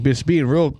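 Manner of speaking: ordinary delivery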